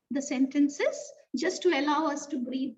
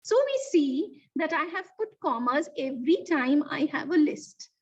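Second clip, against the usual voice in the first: ordinary talk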